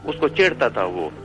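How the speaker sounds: Pashto-tinged retelling, B for BH; Pathan neighbour. Retold or imitated. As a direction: tell it as an animated Pathan neighbour. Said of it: "वो एक Pathan family थी".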